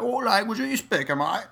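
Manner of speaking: high, hammed-up British accent; squeaky mock Brit asking what language this is. Brit asking an American what language, in a mock British accent. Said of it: "their accent is so thick".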